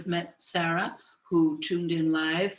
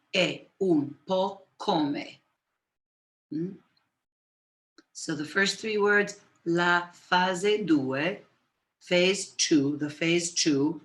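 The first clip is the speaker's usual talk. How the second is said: ordinary talk, nothing marked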